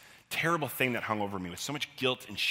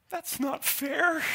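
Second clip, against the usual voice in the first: much higher, slow whine; whiny little kid; much slower than usual. Retold or imitated. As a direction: drag it out like a hurt six-year-old. Six-year-old protests to bully.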